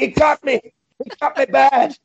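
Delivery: very high panicked cries; hammed-up infected victim; mock panic. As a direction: play a bitten man panicking. Acts Ken's panic at infection.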